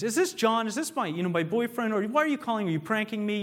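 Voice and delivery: high-pitched, flustered questioning; agitated babysitter. Acted. Babysitter suspecting her boyfriend of a prank, agitated and high. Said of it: "you sound very agitated".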